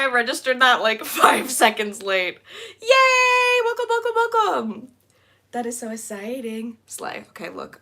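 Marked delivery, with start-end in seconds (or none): 2.8-4.9 high, loud whooping welcome; excited cheer. Real, not acted. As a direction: cheer them in at full voice. Cheering a new member.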